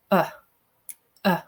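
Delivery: "uh" short and low in the throat; the vowel of put, sounded alone as "uh". Shown as a demonstration. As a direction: repeat "uh" as an isolated deep vowel. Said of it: "is lower".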